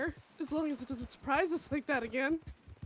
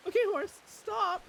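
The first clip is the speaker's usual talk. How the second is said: raised, higher, desperate plea; frantic, pitched-up appeal; exasperated pleading; 6 semitones higher, 4 dB louder than usual. Real, not acted. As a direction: beg loudly for it to stop. Pleading with horse in game.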